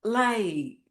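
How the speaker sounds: soft, hushed pride to herself; whisper-close praise to yourself; quietly moved, proud of herself; tender self-praise. Retold or imitated. Talking to herself, alone.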